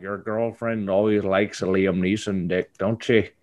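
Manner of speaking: lightly put-on Irish accent, Liam Neeson style; a hint of Irish brogue, mocking. Mock Irish accent to a cuckolded man. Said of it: "I just went Liam Neeson".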